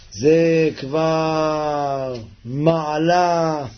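very slow, stretched vowels; drawn-out marvelling. Real, not acted. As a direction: stretch each word out in wonder. Marvels at its high level.

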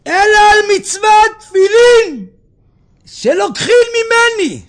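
high, loud outcry of the martyred rabbi; anguished cry. Acted. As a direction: cry out the protest, drawing out the last words. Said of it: "לא על נשמתי אני צועק". (זעקה גדולה של רבי מעונה)

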